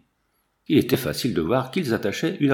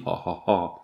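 mock demonic laughter; 5 semitones lower than usual. A narrator's reading. Warriors ridicule Séguin's claim to his daughter, mock demonic laughter. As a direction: read out a sneering ha-ha-ha. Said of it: "leur rire de démons".

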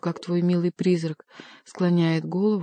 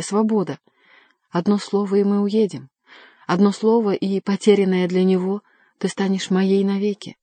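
urgent plea; 3 semitones higher, 5 dB louder than usual. Acted. Lover begs Diana to run away with him, urgent and impassioned. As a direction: urge her with rising passion, louder on key phrases.

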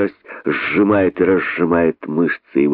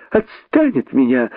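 fighting him off; 5 semitones higher than usual. Acted. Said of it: "отбивался от него находчивый".

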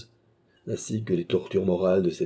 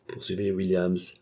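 usual speaking voice

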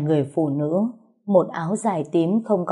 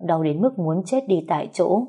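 plain reading voice

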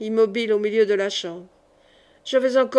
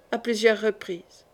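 usual speaking voice

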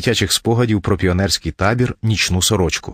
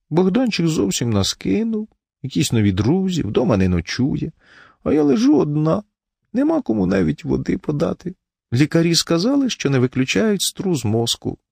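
self-pitying wife; 9 semitones higher than usual. A narrator's reading. Wife lying in bed complains to husband, self-pitying. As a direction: lament weakly, pitched well up.

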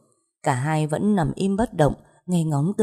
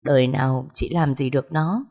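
usual speaking voice

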